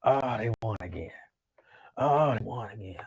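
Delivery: deflated fan muttering to himself; mock disappointment.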